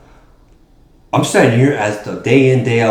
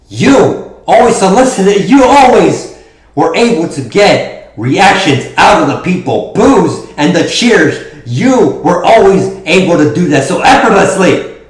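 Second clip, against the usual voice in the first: loud, fervent promo shout; wrestling promo; 9 semitones higher, 8 dB louder than usual. Acted. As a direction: hype up the crowd.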